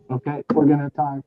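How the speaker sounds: loud and projecting; talking loud. Real, not acted. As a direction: push the voice out. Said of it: "I'm gonna just try and talk loud".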